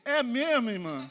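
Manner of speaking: loud, high, mocking laugh on "É mesmo"; the old self flares up, jeering at her; sarcastic retort. Pastor jeering at the sister after her husband's snub, loud mock. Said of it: "o velho homem ressuscitou".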